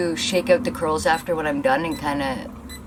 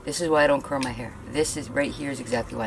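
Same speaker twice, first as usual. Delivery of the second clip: ordinary talk, nothing marked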